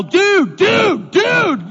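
very high, loud dude repeated in alarm; panicked yell. Retold or imitated. Pastor yelling at the dog owner during the attack, high panicked shout. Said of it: "and I'm all".

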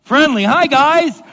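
high, loud, overly cheerful hi to the dogs; nervous friendly greeting. Retold or imitated. Pastor greeting seven loose German Shepherds, nervously cheerful and high. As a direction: try to sound friendly to scary dogs. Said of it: "so they must be friendly".

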